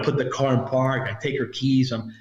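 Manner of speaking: ordinary talk, nothing marked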